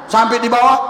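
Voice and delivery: normal talking voice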